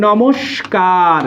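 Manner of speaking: "নমস্কার" very slow, lingering on the sibilant and last syllable; drawn-out word demo. Shown as a demonstration. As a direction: stretch "নমস্কার" out and let it sink at the end. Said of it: "অ হইলে হচ্ছে".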